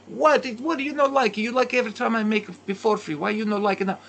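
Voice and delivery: hammed-up hurt grandmother demanding why; offended Italian grandma. Grandmother to grandson who turns down food, high offended nonna voice.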